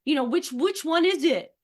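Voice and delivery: high, loud mock indignation; very reactive. Parent reacting to child's mixed demands, mock reactive. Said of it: "you could get very reactive".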